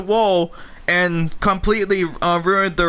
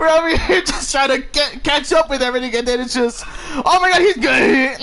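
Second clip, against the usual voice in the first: laughing excitedly; 5 semitones higher than usual. Real, not acted. Overwhelmed, laughing at the chaos.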